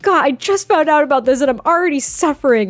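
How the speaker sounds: high, loud mock tirade; chewing him out. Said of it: "she's chewing him out".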